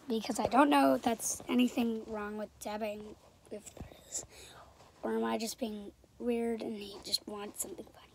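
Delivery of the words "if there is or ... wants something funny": whispering. Real, not acted. Whispered aside about the channel.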